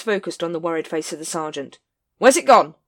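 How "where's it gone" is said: loud, sharp demand. Acted. Vimes demanding of Sergeant Colon, loud and curt. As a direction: snap the question at the sergeant. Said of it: "he demanded".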